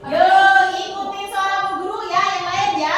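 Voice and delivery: high, lively classroom leader; kindergarten teacher voice. Real, not acted. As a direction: coax the class along brightly. Teacher leads the class chant. (suara guru TK yang ceria)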